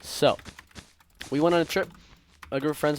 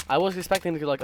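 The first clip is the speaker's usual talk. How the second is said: ordinary talk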